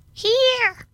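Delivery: very high, squawky call; raspy reply. Acted. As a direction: squawk it like a parrot. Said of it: "comes the raspy reply once again".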